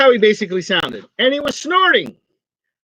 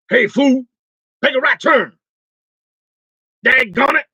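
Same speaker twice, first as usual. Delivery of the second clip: higher, scolding tough-guy navigator; Mister T GPS voice; 6 semitones higher than usual. Pretend. Mister T as a GPS app scolding the driver, higher mock gruff. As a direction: bark directions like Mister T, hammed up. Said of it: "You know, Mister T".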